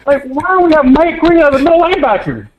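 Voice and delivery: real exasperated high-pitched yelling, laughing in disbelief; crashing out. Real, not acted. Caller ranting to host about the coaching, exasperated yelling. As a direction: rant at the top of your voice, half laughing, half crying. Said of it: "never seen Cam crash out like that".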